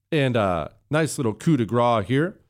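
ordinary talk, nothing marked

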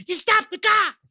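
hammed-up yelp at the driver; mock yelling. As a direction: act a frantic passenger demanding control. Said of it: "Then I started yelling".

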